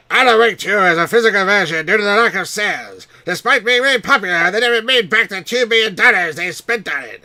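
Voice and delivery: high, very loud cartoon voice; squawky hammed-up cartoon character, full volume; South Park Canadian. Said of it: "you'd be talking like this".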